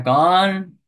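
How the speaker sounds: loud, high barked who; drunk challenge. Retold or imitated. Drunk brother shouting back at the devotees, sharp and loud. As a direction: snap back at the intruder.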